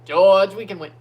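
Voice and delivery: loud attempt at an Austrian accent; badly faked Austrian accent, cheering loudly; Toto Wolff. Fan urging George as team boss, shaky Austrian accent attempt. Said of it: "I can't do the Toto accent".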